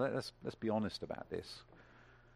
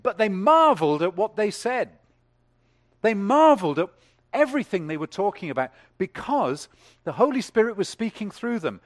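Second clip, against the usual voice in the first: much higher and louder, ringing out marveled; full-force preaching; 10 semitones higher, 14 dB louder than usual. Acted. Preacher exulting to the congregation, full-force. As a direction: preach it out to the rafters.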